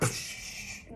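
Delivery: imitated hiss of escaping steam; voiceless spout of pressure releasing; boiling-over burst. Shown as a demonstration. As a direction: let out an explosive "pssh". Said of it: "a pot that's boiling over".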